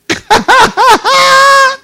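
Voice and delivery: shrieking burst of laughter. Real, not acted. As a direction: let out a high cackle. Congregation laughs.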